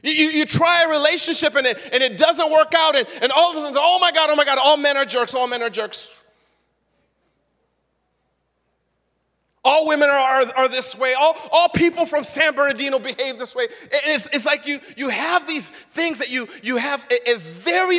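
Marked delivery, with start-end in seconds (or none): 3.8-13.6 loud, high, hammed-up ranting; mock outrage. An adult after a failed relationship rants, loud and mocking. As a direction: sneer at the bitter generalizer.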